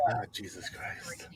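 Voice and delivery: whispered, weary disbelief; exasperated whisper. Real, not acted. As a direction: breathe it out under your breath. Exasperated at staged feud.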